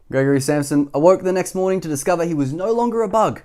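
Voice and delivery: ordinary delivery, nothing marked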